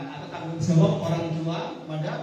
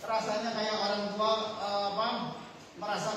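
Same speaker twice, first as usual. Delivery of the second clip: ordinary talk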